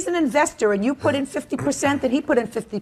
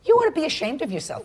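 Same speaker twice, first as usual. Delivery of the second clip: scolding, a touch higher; stern scolding judge; 6 semitones higher than usual. Real, not acted. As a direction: shame him, firmly.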